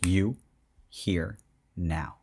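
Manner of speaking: low, spaced-out mock command; lightly put-on daddy voice, each word set apart; daddy mode. Imitating the snapping guy in the gif, mock daddy mode. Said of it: "Like he's just full daddy mode".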